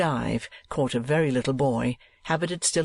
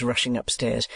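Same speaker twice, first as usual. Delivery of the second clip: plain reading voice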